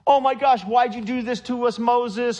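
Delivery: high-pitched, freaked-out outcry; retold frantic complaint, pitched high; mock panicked yelling. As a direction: imitate a crowd yelling in protest. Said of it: "they're yelling and screaming".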